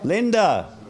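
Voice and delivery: loud, high call across the room. Real, not acted. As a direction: call out to someone who has wandered off. Calling to Linda across the room.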